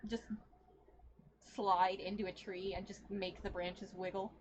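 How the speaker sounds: usual speaking voice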